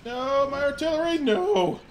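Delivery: high loud wail of loss; anguished cry. Real, not acted. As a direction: cry out as your unit dies. Artillery destroyed.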